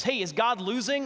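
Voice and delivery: rally the congregation for an answer; calling out to the crowd. Acted. Preacher calling out to the church, loud rallying question.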